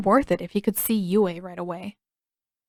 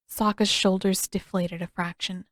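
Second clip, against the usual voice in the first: ordinary delivery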